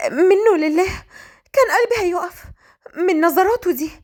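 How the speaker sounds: high-pitched, rattled exclamation; flustered outburst. Acted. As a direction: let the nerves burst out, high-voiced. Flustered heroine catching her breath. (بتوتر وانفعال)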